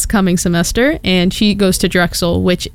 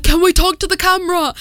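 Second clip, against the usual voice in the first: mock British accent; 9 semitones higher than usual. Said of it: "these four British drunk guys come up".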